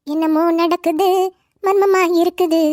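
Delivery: slow, drawn-out suspicion; drawn-out, knowing aside; suspicious musing. Acted. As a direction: stretch the words out as if sensing a mystery. Suspects a secret, thinks aloud.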